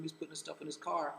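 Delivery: ordinary delivery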